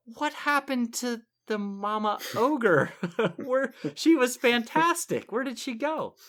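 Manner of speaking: high-pitched, baffled outcry; retold bewilderment. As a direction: play up mock dismay at the disappearance. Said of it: "I was like".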